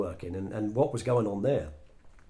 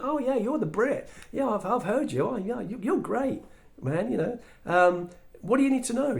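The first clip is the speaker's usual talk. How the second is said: much higher, breezy and easygoing; friendly American guy; 10 semitones higher than usual. Retold or imitated. Roger greeting the visiting Brit, breezy high voice.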